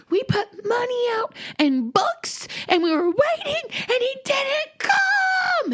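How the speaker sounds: high, wailing mock sob of a child; crying kids. Kids crying to mom that the leprechaun skipped them, high mock sob. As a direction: wail it like a heartbroken kid. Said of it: "They all came in crying".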